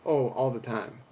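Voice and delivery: normal talking voice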